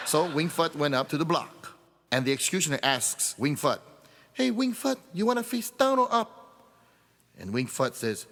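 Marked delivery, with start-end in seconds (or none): 4.3-6.3 executioner's voice. Acted.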